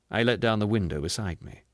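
usual speaking voice

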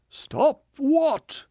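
high, slow, theatrical self-pitying voice; a wounded ham actor milking every line; tragedian. Acted. Tragedian indignant.

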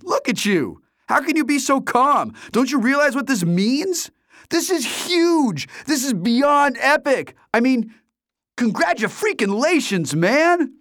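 high, loud, wild rant; excited friend H. A narrator's reading. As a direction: rant at your buddy for not calling. H raving about win.

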